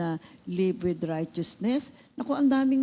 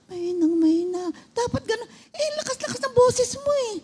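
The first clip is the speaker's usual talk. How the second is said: much higher, whiny imitation; mock weak voice; 4 dB louder than usual. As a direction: play the feeble patient mockingly. Mimicking weak voice, crying sound.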